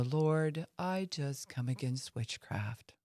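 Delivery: soft, slow, weak-voiced prayer; a feeble, hesitant rebuke; timid prayer. Shown as a demonstration. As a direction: pray it meekly with no authority. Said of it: "I'm probably not gonna use this tone".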